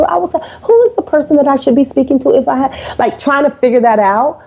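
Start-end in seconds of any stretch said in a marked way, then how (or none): 0.6-3.0 higher, unsure asking; timid question to a gatekeeper; wimpy caller. Retold or imitated. Calling a company, sounding unsure and wimpy. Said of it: "sounding wimpy on the first ten or so calls".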